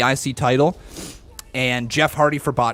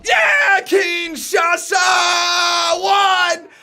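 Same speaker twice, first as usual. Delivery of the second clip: much higher, louder ecstatic yelling; excited cheering; 5 dB louder, much slower than usual. Real, not acted. Fan cheering his tag champions winning, ecstatic yelling. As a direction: scream your joy as the pin lands. His team wins, he celebrates.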